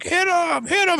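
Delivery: very high mock crowd cry; bloodthirsty crowd. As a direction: egg him on in a squealed imitation. Imitating crowd egging on.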